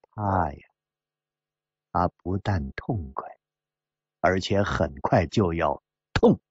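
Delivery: low, soft icy remark; coldly. Acted. Woman warning the gambler of coming pain, coldly. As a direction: drop low and quiet, with cold menace. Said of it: "牛肉汤也冷冷地说".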